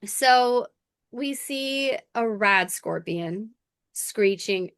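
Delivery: ordinary talk, nothing marked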